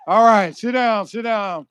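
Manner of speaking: loud order, easing off; calling out. Real, not acted. Speaker telling standing graduates to sit, loud. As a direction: project over the noise, then settle.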